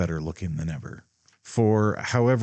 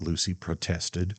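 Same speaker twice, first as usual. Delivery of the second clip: plain reading voice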